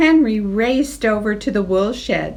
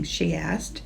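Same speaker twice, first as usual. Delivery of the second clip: ordinary delivery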